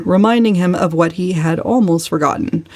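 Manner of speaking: plain reading voice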